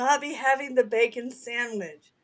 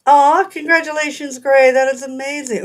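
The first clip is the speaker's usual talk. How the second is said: excited congratulations; 5 semitones higher, 8 dB louder than usual. Real, not acted. Reacting to a viewer's news.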